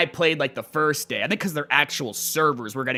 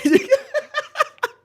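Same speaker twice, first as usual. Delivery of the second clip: high, helpless cackle; real laugh breaking out; burst of laughter; 4 dB louder than usual. Real, not acted. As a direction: crack up at the clip. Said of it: "I'm sorry I'm laughing".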